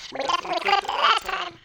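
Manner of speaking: sped-up squeaky high voice; fast, high-pitched cartoon squeak; chipmunk voice. Shown as a demonstration. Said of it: "almost sounded like a chipmunk".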